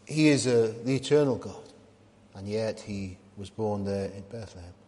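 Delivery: ordinary talk, nothing marked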